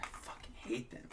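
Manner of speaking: voiceless, seething under the breath; angry whisper. Retold or imitated. Kid curled up after a beating, whispering hate. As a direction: hiss it so nobody hears. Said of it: "I'm like".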